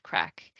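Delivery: "crack" said just like the English word; pronunciation demo. Shown as a demonstration. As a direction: say "crack" as plain English, rhyming with "back". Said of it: "it's pronounced like the English word".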